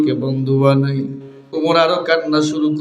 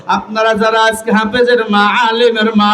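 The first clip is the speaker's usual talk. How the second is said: higher, chanted address; fervent preaching; 6 semitones higher, 4 dB louder than usual. Real, not acted. Preacher praising mothers of Hafez students, fervent chanted address. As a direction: lift the voice and draw out line endings.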